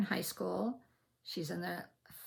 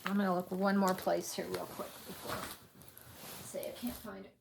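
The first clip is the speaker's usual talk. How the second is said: normal talking voice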